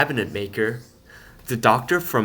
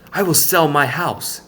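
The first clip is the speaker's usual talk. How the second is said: determined decision; 5 semitones higher, 7 dB louder than usual. A narrator's reading. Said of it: "he decided".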